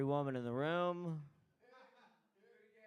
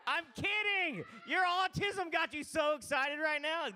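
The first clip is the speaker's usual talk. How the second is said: shouting back; 9 semitones higher than usual. Real, not acted. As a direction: yell it over the room at him. Host shouting back at heckler.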